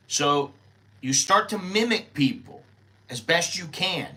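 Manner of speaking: ordinary delivery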